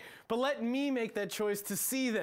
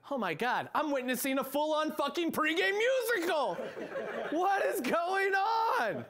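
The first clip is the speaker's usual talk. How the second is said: rising disbelief, ending in a laughing shout; shocked realization; 7 semitones higher than usual. Retold or imitated. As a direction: act stunned by what you see. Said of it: "and then I realize".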